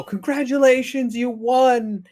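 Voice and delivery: high, loud cheery game voice; cheery game announcer. Retold or imitated. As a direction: announce the win with fake cheer. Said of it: "it's gonna go".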